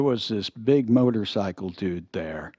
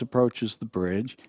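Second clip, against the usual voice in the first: ordinary delivery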